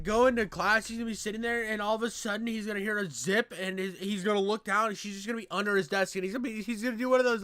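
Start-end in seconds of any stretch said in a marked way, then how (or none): none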